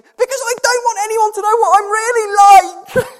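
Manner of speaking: high, very loud wailing tantrum; retold meltdown. Dad mimics daughter confessing in a meltdown, high wailing shout. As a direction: mimic a child flipping out at full shriek. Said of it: "she absolutely flipped".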